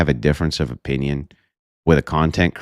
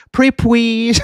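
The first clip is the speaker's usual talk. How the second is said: high, whiny pleading voice; baby-voiced begging; 5 dB louder than usual. Mocking Brian begging Andrew for clout, high whiny voice. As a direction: beg like a needy kid. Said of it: "He says".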